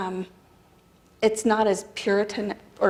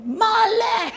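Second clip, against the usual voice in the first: high, loud call of "Male!"; mock yell across the property; 14 semitones higher, 5 dB louder than usual. Big tenant yelling greeting across the property, high and loud. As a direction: call "Male!" from far away. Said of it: "he would yell".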